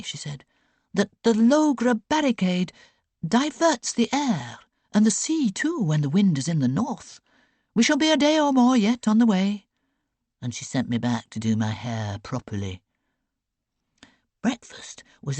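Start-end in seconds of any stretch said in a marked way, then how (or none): 0.9-9.7 put-on higher, brisk elderly aunt informing her niece; crisp, knowing aunt passing on sailors' news; Aunt Beck's voice. A narrator's reading. Aunt briefing niece on the voyage, brisk higher aunt voice.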